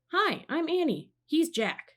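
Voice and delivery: high, bright call out; friendly yell. A narrator's reading. Annie greets the octopus through the window, high friendly yell. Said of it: "He yelled to the octopus".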